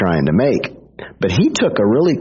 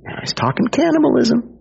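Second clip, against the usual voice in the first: mock alarmed follower; 12 semitones higher than usual. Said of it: "They're like".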